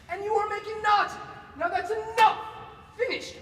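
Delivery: high, loud scolding; exasperated outburst. Acted. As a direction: put your foot down in outrage. Fed-up tirade.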